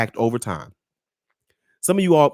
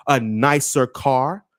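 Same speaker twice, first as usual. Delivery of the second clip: ordinary talk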